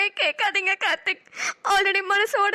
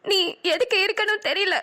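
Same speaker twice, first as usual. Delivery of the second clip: ordinary delivery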